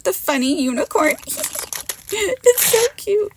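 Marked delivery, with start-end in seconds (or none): 1.2-2.1 a soft airy giggle, delighted; breathy giggle. Real, not acted. Crafter delighting in the unicorn stamp, airy giggle. 2.2-3.3 high and slow, gushing over something cute; cooing. Real, not acted. Crafter gushing over her unicorn stamp, high slow coo.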